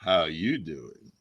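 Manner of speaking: very low, drawn-out creepy greeting; slow, deep voice meant to unnerve; scary creep. Pretend. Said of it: "That was pretty scary".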